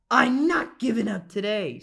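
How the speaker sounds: put-on old lady swearing she won't quit; determined vow. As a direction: declare it to the whole forest. Lady vows to find a bear.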